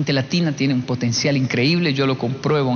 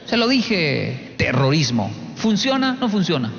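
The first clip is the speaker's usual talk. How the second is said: normal talking voice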